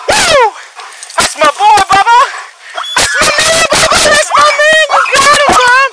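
high, loud, gushing praise for the dog; thrilled handler praising his K9; excited dog praise. Real, not acted. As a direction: cheer your dog on like he won the game. Handler praising dog after bite.

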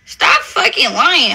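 normal talking voice

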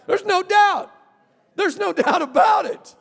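high, loud preacher shout; preaching shout. Real, not acted. Preacher shouting to the church about the risen King. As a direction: shout out the certainty.

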